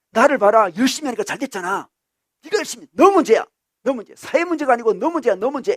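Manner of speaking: loud, high finger-pointing tone; accusing insistence. Acted. Conservative ideologue blaming the individual, loud accusation. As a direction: hammer home that they are to blame. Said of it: "이렇게 주장합니다".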